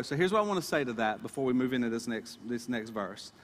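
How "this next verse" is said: ordinary talk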